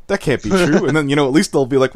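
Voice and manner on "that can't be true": audience shock. Retold or imitated. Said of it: "make my audience going".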